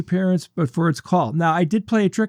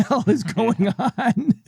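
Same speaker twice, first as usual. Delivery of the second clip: baffled reaction; 4 semitones higher, slower than usual. Retold or imitated. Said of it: "my response was".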